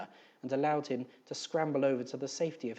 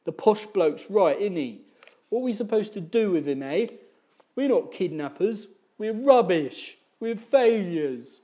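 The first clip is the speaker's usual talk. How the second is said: wailing despair; 6 semitones higher, 9 dB louder than usual. Acted. Eric wailing to his mother, a loud high lament. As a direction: sob out that it is hopeless. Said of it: "wailed Eric".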